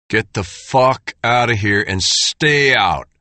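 slow, menacing thug; menacing threat. Acted. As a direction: growl the order slowly. Oldest skinhead orders Dale away.

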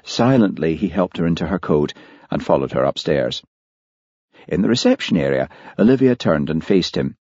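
ordinary delivery

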